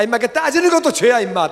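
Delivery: high, loud, quick comic telling-off; mock exasperated scolding. Dad telling his arguing little son off, mock exasperated. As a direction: act out a dad losing patience.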